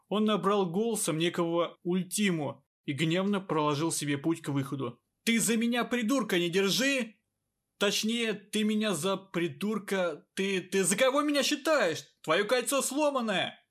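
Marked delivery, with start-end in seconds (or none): 5.2-13.6 high angry phone rant; angry shouting. Acted. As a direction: yell into the phone, furious. Emperor yells on phone.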